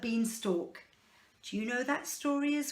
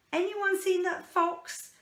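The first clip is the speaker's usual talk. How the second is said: anxious little pig, higher; higher worried pig; 8 semitones higher than usual. Acted. Worried pig asking others about the fox, higher. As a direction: call out nervously for the fox.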